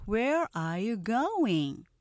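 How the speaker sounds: slow, stretched question for repeating; deliberate, very slow English demo; slow-speed model. Shown as a demonstration. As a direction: draw each word out for learners. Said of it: "먼저 느린 속도 발음 듣고".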